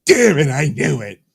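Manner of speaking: put-on angry wife; mock angry wife. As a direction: snap it like a wife catching him. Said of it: "And so she's like".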